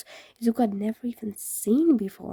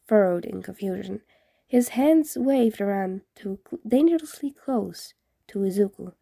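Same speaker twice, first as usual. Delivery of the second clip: usual speaking voice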